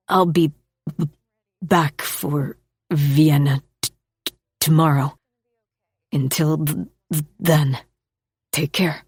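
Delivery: a shy man tripping over his words; nervous stammering man. Acted.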